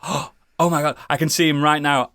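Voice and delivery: normal talking voice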